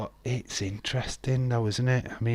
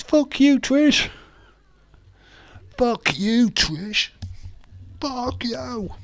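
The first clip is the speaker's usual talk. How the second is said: high, loud mock curse; mock yell; 6 dB louder, slower than usual. Streamer imagines the sign swearing at Trish, high loud mock yell. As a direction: bark it out like a furious wrestler. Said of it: "I thought you were gonna say".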